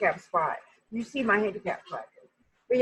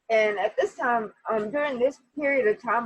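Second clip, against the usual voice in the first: normal talking voice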